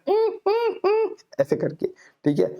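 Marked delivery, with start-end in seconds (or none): 0.1-1.2 high whiny dog whimper; high pitched imitated dog whine; imitating a dog. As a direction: mimic the pet dog begging for a walk. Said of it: "खूब जोर से चिल्लाता है".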